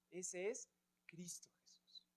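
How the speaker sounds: hushed whisper on the name; whispered reverence. Real, not acted. Teacher to class, whispering the name of Christ.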